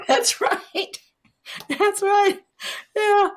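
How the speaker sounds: high-pitched words through laughter; laughing. Real, not acted. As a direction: say it while still laughing. Guest laughing at T-shirt joke.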